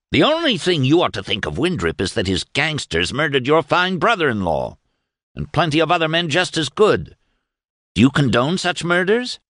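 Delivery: high-pitched indignant challenge; indignant confrontation. A narrator's reading. Father angrily confronts son over killings.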